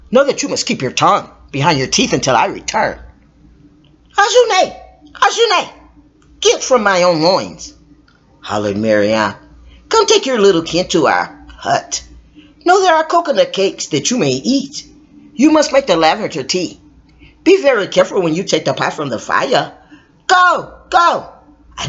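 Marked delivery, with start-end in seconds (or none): 4.2-7.7 high calling call. Acted. Mother calling her child Ajune, high call. 20.3-21.3 high quick urging; shooing. Acted. Mother sending the child away, high urging.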